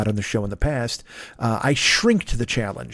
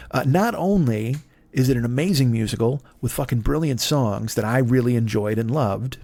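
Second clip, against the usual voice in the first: ordinary delivery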